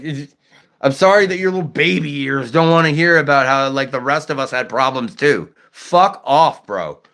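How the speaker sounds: ordinary talk, nothing marked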